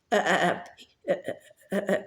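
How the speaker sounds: soft wheezing cackle, partly voiceless; breathy cackle. A narrator's reading. Son cackling at the mockery, wheezing cackle. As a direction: giggle under the breath. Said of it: "Morphin cackled again".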